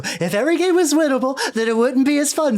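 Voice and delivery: a baffled clown stating the rules; confused clown. Acted. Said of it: "the confused face go".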